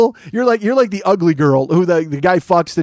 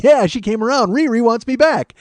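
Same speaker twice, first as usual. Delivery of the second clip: higher, crowing delivery; gloating boyfriend; 7 semitones higher, 4 dB louder than usual. Retold or imitated. [name] after the phone call, mock triumphant crowing. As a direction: imitate a cocky, triumphant dim guy. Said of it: "He's like".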